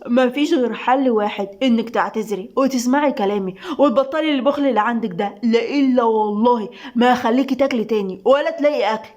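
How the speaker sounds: usual speaking voice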